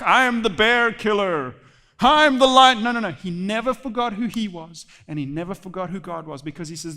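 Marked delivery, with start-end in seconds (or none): 0.0-2.8 loud, puffed-up braggart; mock boasting. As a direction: swagger it out, hammed-up and loud. Mocks a boast David never made.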